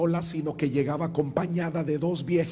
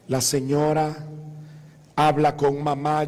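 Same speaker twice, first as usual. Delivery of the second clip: ordinary talk, nothing marked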